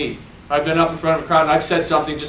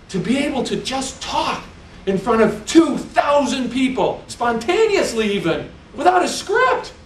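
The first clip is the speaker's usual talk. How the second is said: high, far-off voice, rising higher to the end; awed assistant director; 7 semitones higher than usual. Retold or imitated. Director marvels at speaking to two thousand, awed voice. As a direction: mimic the director's wide-eyed amazement. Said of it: "And he said".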